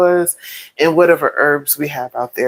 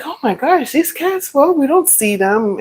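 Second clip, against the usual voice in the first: mock surprise; 10 semitones higher than usual. Family puzzled about cats.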